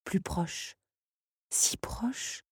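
murmure de plus en plus bas; souffle-le comme une menace proche; chuchoté. A narrator's reading. Son qui approche, tension. (le narrateur chuchote que le son approche, murmure tendu)

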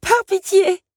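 desperate high cry; imploring. A narrator's reading. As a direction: beg loudly for mercy. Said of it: "implora-t-elle". (supplication désespérée, cri aigu)

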